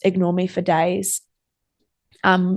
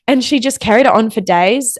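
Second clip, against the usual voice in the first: ordinary delivery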